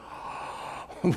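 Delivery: sharp breathless gasp of wonder; jaw-dropping intake of breath; awed gasp. Retold or imitated. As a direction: gasp as if glimpsing heaven. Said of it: "your mouth would just drop open and you'd go".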